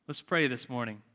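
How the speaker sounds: usual speaking voice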